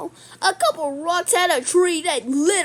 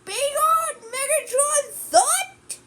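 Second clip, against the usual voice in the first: a slow, much higher hammed-up hero line; Superman quote; 10 semitones higher, slower than usual. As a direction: ham up a cartoon hero taunt. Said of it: "Like Superman in Justice League Unlimited".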